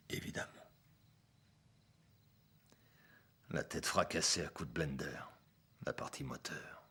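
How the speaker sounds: low, soft voice with heavy pauses; subdued, bitter confession. Acted. Ex-cop confesses his mistake to Walter, subdued and grave. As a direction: weigh each phrase quietly, like a hard lesson. Confesses the fatal half-measure.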